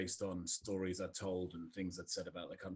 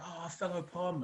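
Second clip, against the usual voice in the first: higher-pitched mock delight at meeting a countryman; excited greeting; 9 semitones higher than usual. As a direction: imitate an eager stranger hailing you. Expats greeting him as countryman.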